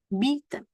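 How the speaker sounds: "Bieten" with a stretched ee; long I. Shown as a demonstration. Teacher giving an I E example, long ee in "Bieten". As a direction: lengthen the first vowel of "Bieten". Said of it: "I-nya panjang".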